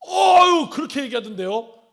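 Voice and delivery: gushing over the rumor; loud thrilled report; excited gossip. Retold or imitated. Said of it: "이렇게 얘기한 거예요".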